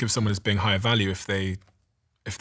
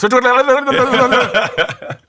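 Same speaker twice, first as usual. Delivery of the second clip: loud, high-pitched gibberish chatter; mock rapid babble; 11 dB louder than usual. Imitating a New York office colleague, frantic high babble. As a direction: imitate a non-stop talker in a shrieky gabble.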